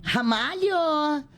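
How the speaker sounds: a slow, high, drawn-out call of "Ramalho!" with a carioca lilt; calling out "Ramalho!" slowly, the way the director heard it; carioca-sounding Ramalho. Retold or imitated. Actress recalling her stage line, calling out Ramalho with a drawn carioca lilt. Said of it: "e aí eu falava".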